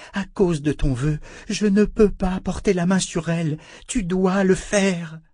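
high, tearful insistence, stretched at the ends; on the verge of tears. A narrator's reading. Shota tells Richard he must act, near tears. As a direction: urge it while holding back tears. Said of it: "dit Shota au bord des larmes".

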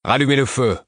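lancé fort par-dessus l'épaule; tossed-off command. A narrator's reading. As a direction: bark the order while walking away. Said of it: "lançai par-dessus mon épaule".

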